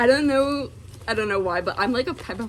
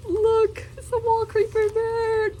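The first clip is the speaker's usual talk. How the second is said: high excited show-off; 13 semitones higher than usual. Real, not acted. Showing the bird design.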